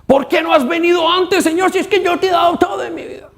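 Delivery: high, shouted reproach at God; angry complaint. Retold or imitated. Immature believer shouts complaint at God, high angry shout. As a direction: demand it, voice climbing to a shout. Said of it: "el reclamo de los inmaduros en la fe".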